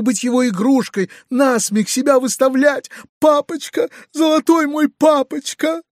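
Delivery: tearful pleading. A narrator's reading. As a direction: plead through tears with your father. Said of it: "заливаясь слезами". (дочь в слезах умоляет отца)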